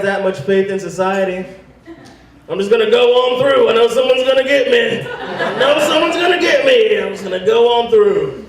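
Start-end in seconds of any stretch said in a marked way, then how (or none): 2.5-8.4 high, loud, smug self-talk; sing-song confident freeloading; smug freeloader. Pretend. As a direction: driver expecting a free meal, high and smug.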